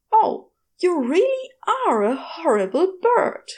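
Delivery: high, odd put-on voice for the goose; a lightly voiced goose, rather haughty. Goose talking back in story.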